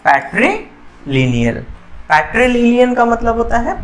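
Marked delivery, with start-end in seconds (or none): 0.0-1.6 slow answer word. Shown as a demonstration. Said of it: "तो आपका answer क्या हो जाएगा?".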